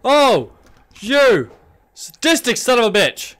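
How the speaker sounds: high, loud frightened yelling; terrified scream. Real, not acted. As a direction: scream at the monster. Monster attack, screaming at it.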